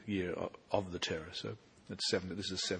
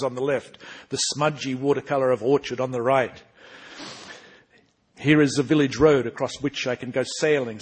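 lively, louder reading of the novel's first-person hero; story narration; 5 semitones higher, 14 dB louder than usual. Acted. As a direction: read it out like a proud young noble introducing himself. Author reads novel opening.

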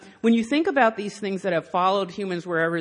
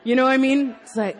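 ordinary talk

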